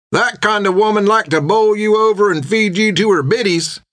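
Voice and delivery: high-pitched drinking mate teasing his friend; tavern companion. Acted.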